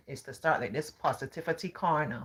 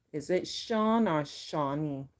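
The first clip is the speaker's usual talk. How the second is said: normal talking voice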